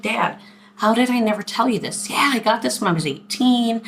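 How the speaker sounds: high, lightly acted excited explaining; animated retold confession. Daughter telling dad about her old tattoo, animated retold confession. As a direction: play up the belated confession, voice lifted.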